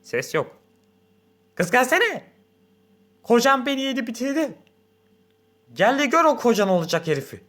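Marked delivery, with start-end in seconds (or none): 1.5-7.4 high, loud call to her daughter; angry mother shouting for her daughter; rude, fierce. A narrator's reading. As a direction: bawl it out, coarse and greedy. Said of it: "Kaba, hırslı".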